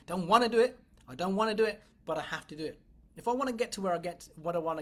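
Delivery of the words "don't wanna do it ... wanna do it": louder, petulant refusal; loud reluctant protest. Retold or imitated. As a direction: protest like a kid who won't do chores. Resisting tasks she must do.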